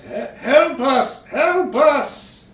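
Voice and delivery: put-on high, loud, pleading old man; begging old man crying for help; desperate old man.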